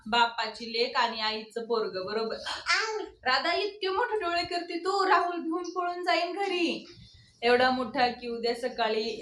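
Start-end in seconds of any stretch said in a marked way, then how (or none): 3.2-6.9 put-on higher, sing-song baby talk. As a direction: coo at the baby. Talking to the baby. (बाळाशी उंच आवाजात लाडिक बोलणे)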